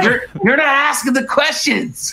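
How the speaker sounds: high teasing scold; mock scolding. Teasing interviewer.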